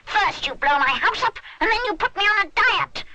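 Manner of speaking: high, loud outraged creature; furious creature. A narrator's reading. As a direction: rant like a cross little beast. Creature rages.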